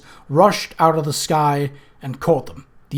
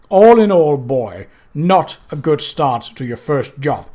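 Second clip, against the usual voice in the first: louder, building angry rebuke; Death's cold fury; 2 semitones higher, 5 dB louder than usual. Acted. Death berates Mort, louder, cold and furious. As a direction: scold the apprentice with contained rage.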